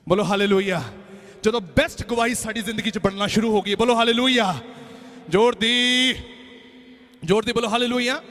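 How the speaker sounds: loud call for a congregational response; rallying preacher. Real, not acted. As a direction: belt it out to rouse the congregation. Rallies the congregation. (ਜ਼ੋਰਦਾਰ ਆਵਾਜ਼ ਵਿੱਚ ਸੰਗਤ ਨੂੰ ਪੁਕਾਰ)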